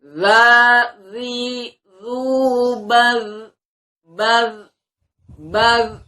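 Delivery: loud, high zal syllables; makhraj drill. Shown as a demonstration. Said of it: "Coba sekali lagi kita ucapkan makhraj zal".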